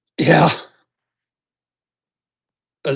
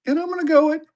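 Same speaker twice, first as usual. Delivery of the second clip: sudden high, raised voice on the go; 9 semitones higher, faster than usual. Real, not acted. Seller hyping a dollar-and-go auction to viewers, raised high voice. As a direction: lift the voice up high as if hyping the sale. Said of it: "I don't know why I raised my voice".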